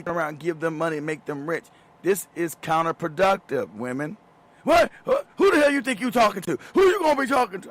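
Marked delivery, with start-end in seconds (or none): 4.6-7.7 higher and louder outrage; mock indignation. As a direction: bristle like they've been insulted. Mocking angry forum women.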